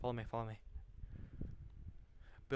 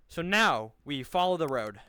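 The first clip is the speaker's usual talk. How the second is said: calling out; 7 dB louder than usual. Real, not acted. Leading squad, calling out orders.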